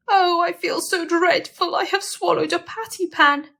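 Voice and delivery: high, whiny moan; moaning and whining. Acted. Duchess whining to Ruby about her pain, high moan. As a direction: whimper it like a sick patient. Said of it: "Duchess moaned and whined".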